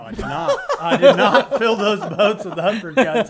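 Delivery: loud protest broken by laughter; laughing denial. Real, not acted. Answering a teasing question about uppercuts, laughing denial. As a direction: deny it while cracking up.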